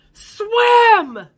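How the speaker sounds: loud, high, drawn-out yell; shouted command. Retold or imitated. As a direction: yell it across the water, stretched long. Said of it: "rowed his boat over and said to me".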